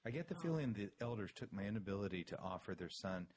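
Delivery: low, measured public-radio reading; subdued, low radio-host delivery; hushed NPR voice. Pretend. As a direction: read the email soberly, as if on NPR. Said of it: "N P R".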